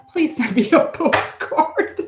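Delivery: joking request dissolving into a laugh; laughing plea. Real, not acted. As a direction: crack up while asking.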